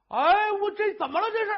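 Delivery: high, loud shocked outburst; startled cry. Acted. Husband finds his wife hanging, crying out in shock. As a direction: jump in alarm at what you see. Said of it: "贾大爷一瞧吓一跳".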